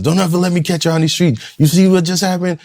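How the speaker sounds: high, loud, fast angry rant; furious yelling. Retold or imitated. Father yelling at his sons on the street, furious rant. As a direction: chew them out like a scared, shamed father. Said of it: "I'm yelling and screaming at my sons".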